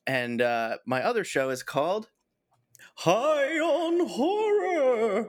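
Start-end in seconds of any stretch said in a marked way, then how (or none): 2.9-5.3 slow, high, drawn-out horror title; spooky announcer voice. Shown as a demonstration. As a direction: stretch it out like a horror host. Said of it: "he did it".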